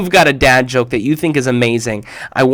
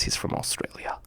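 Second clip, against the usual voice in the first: hushed excited aside; whisper; 17 dB quieter than usual. Real, not acted. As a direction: whisper like sharing a secret. Whispered aside about guest.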